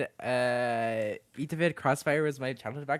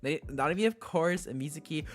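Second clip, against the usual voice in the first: normal talking voice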